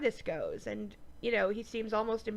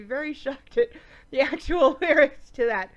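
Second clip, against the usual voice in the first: giggling through the words; laughing; 3 semitones higher, 8 dB louder than usual. Real, not acted. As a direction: laugh while you say it. Laughing at the song lyrics.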